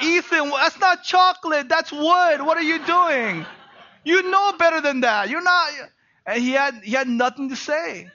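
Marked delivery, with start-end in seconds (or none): 0.0-5.7 high, loud exasperated scolding, half laughing; mock-stern dad telling off his son. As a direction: scold the kid who chewed the furniture. Dad scolding son chewing bed frame.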